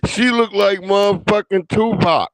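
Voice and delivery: loud, high laughing roast. Real, not acted. Streamer roasting the woman, loud and high. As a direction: shout the joke.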